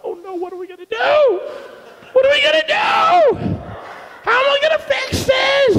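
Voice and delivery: hammed-up worry, voice shooting up; mock panic. Worrier alone at home after church, high mock panic. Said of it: "You go".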